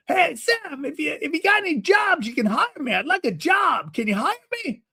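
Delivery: put-on high old man asking a favor; eager older man. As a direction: angle for work, pitched high. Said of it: "It's how he talks".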